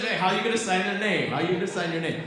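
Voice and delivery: lightly voiced protesting dad; indignant challenge. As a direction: demand an answer like an exasperated father. Acts out dad's indignant objection.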